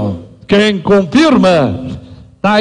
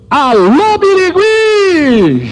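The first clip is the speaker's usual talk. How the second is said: calling out; 9 semitones higher, much slower than usual. Real, not acted. Auctioneer greets a town loudly.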